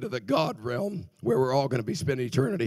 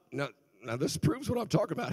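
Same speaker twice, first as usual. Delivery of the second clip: ordinary talk, nothing marked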